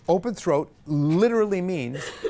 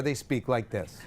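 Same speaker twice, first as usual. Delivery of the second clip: closed-throat everyday voice; 5 semitones lower, 5 dB quieter, slower than usual. Shown as a demonstration. Teacher shows announcers off the air, closed-throat voice. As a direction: talk through a closed throat. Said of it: "When they're off the air".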